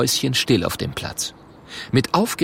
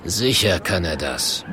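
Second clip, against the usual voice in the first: grumbled growl; 4 semitones lower than usual. Acted. The Murk reassuring the paying troll, low grumble. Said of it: "brummt der Murk".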